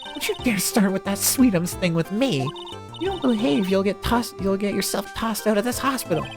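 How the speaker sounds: stern, forceful telling-off; scolding nurse. Acted. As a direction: threaten him like a strict nurse.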